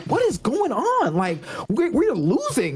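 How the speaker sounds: high-pitched, drawn-out whine of disbelief; upset young daughter. Retold or imitated. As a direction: imitate a daughter mad at her losing team. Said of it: "she gets mad when they stink".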